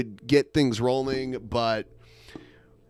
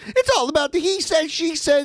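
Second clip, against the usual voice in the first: high mock voice. Parody rap line.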